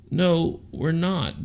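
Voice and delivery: normal talking voice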